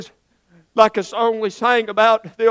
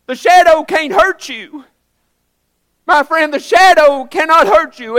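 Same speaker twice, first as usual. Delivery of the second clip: louder rising preacher's exhortation; preaching; 4 semitones higher, 5 dB louder than usual. Acted. Preacher assuring the congregation the shadow cannot hurt, fervent. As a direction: drive the point home to the pews.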